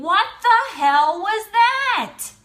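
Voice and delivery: slow, loud, high-pitched astonishment; stunned disbelief. Retold or imitated. Woman thinking after stranger walks away, loudly stunned. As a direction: stretch each word out in big disbelief.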